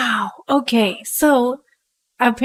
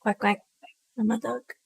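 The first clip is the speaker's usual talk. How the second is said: quiet duck line; 8 dB quieter than usual. Pretend. Repeating the duck joke quietly.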